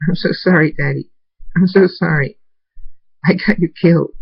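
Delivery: put-on high, tearful child voice; crying apology. A narrator's reading. Abel to Daddy, guilty for what happened, crying apology. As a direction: apologise as if crying into someone's chest. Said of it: "Abel cries into my chest and says".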